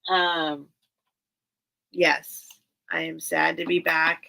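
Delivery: usual speaking voice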